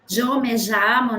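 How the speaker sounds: "yo me llamo" with a zh sound on "yo" and "llamo"; rioplatense yo. Shown as a demonstration. Teacher shows her own way, yo as zho, llamo as zhamo. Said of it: "Yo siempre pronuncié yo".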